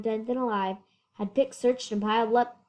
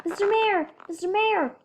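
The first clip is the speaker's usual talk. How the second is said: corrected voice; 9 semitones higher than usual. A narrator's reading. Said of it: "Brian called".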